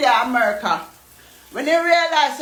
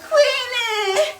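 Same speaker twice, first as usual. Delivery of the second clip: excited greeting; 11 semitones higher than usual. Retold or imitated. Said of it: "I step out and I be like".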